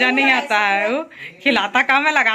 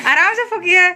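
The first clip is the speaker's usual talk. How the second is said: a real laugh bubbling through; 6 semitones higher than usual. Real, not acted. Mother laughing as the child blows the candles.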